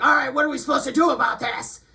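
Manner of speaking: hammed-up impatient guy barking a demand; impatient dudes. Mocking impatient men who demand answers from her, mock shout. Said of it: "you're like".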